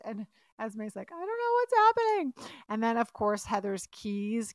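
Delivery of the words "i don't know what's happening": high, fast frightened blurt; mock panic. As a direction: imitate a panicked girl, pitched way up and rushed. Retold panicked quote of frightened Esme.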